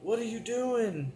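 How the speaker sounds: high, panicked voices of crying relatives; frantic family yelling. Retold or imitated. Friends yelling over him in mock panic. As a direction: pitch up high like scared family. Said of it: "they're yelling".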